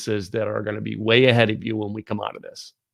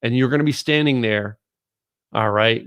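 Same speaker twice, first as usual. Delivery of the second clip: ordinary talk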